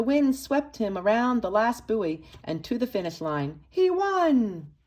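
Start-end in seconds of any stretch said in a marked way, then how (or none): 3.7-4.7 high, bright victory cry; he won, and you're thrilled for him; triumphant cheer. A narrator's reading. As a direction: celebrate the win with a higher, louder voice. Boy wins pretend sailboat race.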